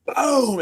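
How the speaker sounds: high boom sound effect; imitated boom. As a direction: shout the boom like a blast. Imitating the explosion.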